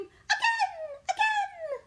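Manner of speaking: high, loud gleeful yell; child's scream. A narrator's reading. Little Pea flung high, screaming with delight. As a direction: squeal it like a thrilled kid. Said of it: "Little Pea would scream".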